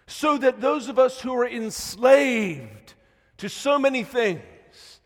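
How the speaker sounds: loud, high-pitched sermon climax; impassioned preacher at a shout; full-force preaching. Real, not acted. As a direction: thunder it out to the congregation, drawing out key words.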